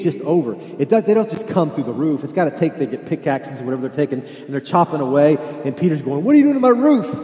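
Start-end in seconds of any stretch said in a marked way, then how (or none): 6.2-7.2 high, loud indignant cry; outraged yell. Acted. Homeowner Peter yelling up at men digging through his roof. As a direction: scream at the vandals on your roof.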